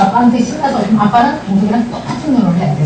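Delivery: ordinary delivery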